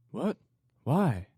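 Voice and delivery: high, loud, alarmed questions; startled exclamation. A narrator's reading. Wife hearing of a hospital riot, exclaiming in alarm. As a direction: jolt up in shock. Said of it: "she exclaimed".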